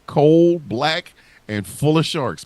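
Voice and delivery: slow, loud, drawn-out threat; spooky slow warning; menacing warning. Acted. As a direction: lean on each word like a horror trailer.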